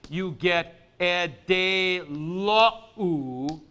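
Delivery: e de lo u said slowly, syllable by syllable; syllables separated. Shown as a demonstration. Teacher showing the contraction to students, slow. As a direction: space out e de lo u slowly. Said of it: "you're going like this".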